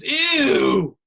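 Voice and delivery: high, loud disgusted shout of ew. Retold or imitated. Guests hearing about American tacos, loud disgusted shout. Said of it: "people's response is always".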